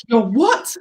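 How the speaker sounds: mock-shocked "what?" rising up; stunned disbelief. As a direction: play him floored by the news. Man stunned she has daughter.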